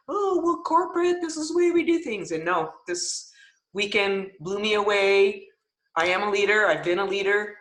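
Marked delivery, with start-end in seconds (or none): 0.1-2.3 high, quick prim corporate parroting; mock corporate voice. To the team, mocking her old corporate self, high and quick. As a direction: mimic the stiff corporate line, pitched way up. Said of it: "I've just been".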